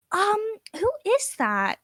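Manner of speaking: slow, airheaded bimbo voice; ditzy blonde. Acted. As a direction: play dumb and vague, drawing it out. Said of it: "playing the absolutely stupid, like, blonde bimbo".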